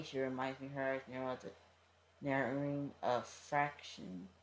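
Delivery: ordinary delivery, nothing marked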